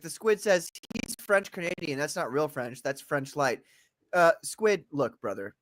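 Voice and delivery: ordinary talk, nothing marked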